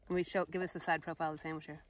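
ordinary delivery

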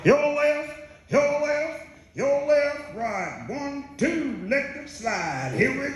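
high, sung out drill call; marching cadence call. Retold or imitated.

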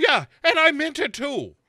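high, loud, defiant Sarah mimicry. [name] as Sarah talking back to the killer, high and defiant. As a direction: shoot back high and cocky. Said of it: "And she's like".

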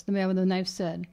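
plain reading voice

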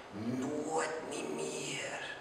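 very slow raven whisper. Acted. The raven answering the grieving poet in a slow whisper. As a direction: breathe the words out like a ghostly bird. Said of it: "Toen sprak die vogel".

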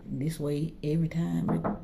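ordinary delivery

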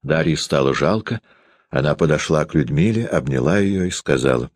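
ordinary delivery

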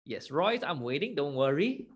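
normal talking voice

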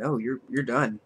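usual speaking voice

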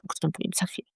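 a quick, soft whisper tucked in; whispered aside. Real, not acted. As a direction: whisper it as a teaser. Whispered aside about webinar. (szeptem, jak zapowiedź na boku)

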